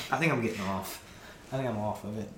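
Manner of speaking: ordinary delivery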